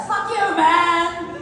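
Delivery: high-pitched mock insult from a novelty box; voice box prank. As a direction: squawk it high, like a prank gadget. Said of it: "a box would say something disgusting".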